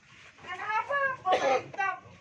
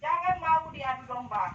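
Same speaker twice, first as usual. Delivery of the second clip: ordinary delivery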